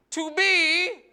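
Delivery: high, loud theatrical declamation; Shakespeare declaiming. Acted. Comic quoting Shakespeare to an audience, loud mock-theatrical delivery. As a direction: declaim it grandly, leaving long pauses. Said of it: "He said".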